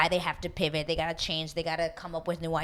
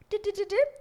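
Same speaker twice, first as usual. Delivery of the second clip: high singsong. Pretend. Playful sound effect.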